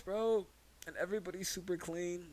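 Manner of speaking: ordinary talk, nothing marked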